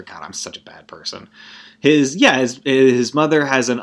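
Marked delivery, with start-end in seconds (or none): 0.0-1.3 much softer, lower, half to herself; quiet guilty self-reproach; muttered to self. Real, not acted. Self-reproach after mocking sick mother.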